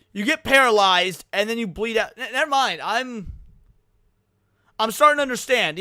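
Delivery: ordinary delivery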